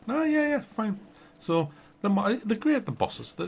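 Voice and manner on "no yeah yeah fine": squeaky high yes, yes, fine; high mock reply. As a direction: pitch it way up like a nervous lad. Player answers in high mock voice.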